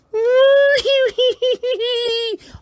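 very high, squealing mock wail; long squeaky pretend bawling; puppet crying. Puppet told he is not a dog, squeaky crying. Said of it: "he's crying now".

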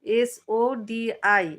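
slow, letter-by-letter spelling. Shown as a demonstration. Host puzzling over a viewer's name, spelling it slowly. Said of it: "উচ্চারণটা কেমন হবে".

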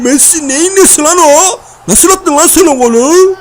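high, loud and slow in disbelief; amazed disbelief. Real, not acted. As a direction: stretch the words out, stunned by the goal. Amazed at Messi's goal.

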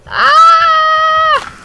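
high, loud sudden yell; sharp high shout of surprise; loud shocked yell. Real, not acted. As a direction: yelp at the impact. Crash in the game.

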